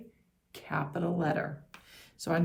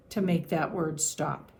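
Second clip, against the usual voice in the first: ordinary talk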